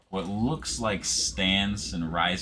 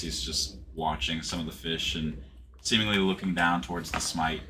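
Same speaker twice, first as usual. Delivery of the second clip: usual speaking voice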